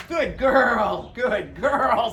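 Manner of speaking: excited praise. Real, not acted. Praising the dog.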